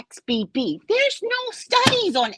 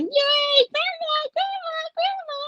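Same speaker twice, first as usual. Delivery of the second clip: very high, gleeful mock chant; hammed-up celebration; dancing cheer. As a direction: squeal it like an excited kid. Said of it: "have them dancing".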